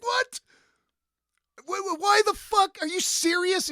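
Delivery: high, incredulous what; shocked disbelief. Retold or imitated. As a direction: squeak out your disbelief. Disbelief at the offer.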